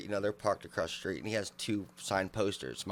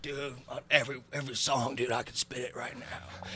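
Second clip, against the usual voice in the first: put-on drunk boast; 5 semitones higher, 3 dB quieter than usual. Drunk fan boasting to rapper.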